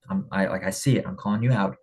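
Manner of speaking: ordinary talk, nothing marked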